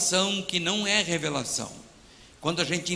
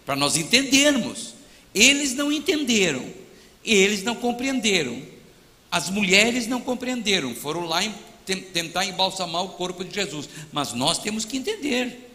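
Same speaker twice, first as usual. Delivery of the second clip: normal talking voice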